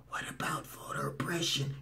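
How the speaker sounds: whispered, meek imitation; mock passive. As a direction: whisper it as if timidly asking. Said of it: "like you just passively said".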